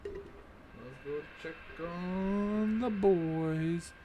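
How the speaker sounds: very slow, stretched mock sing-song; mock drawn-out voice. Player parodying the game line, very slow mock voice. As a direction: drag every word out in a silly voice.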